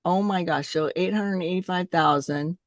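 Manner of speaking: normal talking voice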